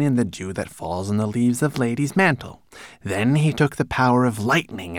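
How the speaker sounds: high, grand storytelling recitation; obediently recited. A narrator's reading. Manikin flattering his master with his legend, reciting grandly. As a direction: declaim the legend, building to the name. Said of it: "Twigleg obediently recited".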